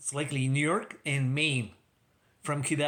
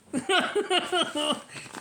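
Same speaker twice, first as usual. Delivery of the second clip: high-pitched burst of laughter; 13 semitones higher than usual. Real, not acted. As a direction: laugh high at your own joke. Laughing at Rush jab.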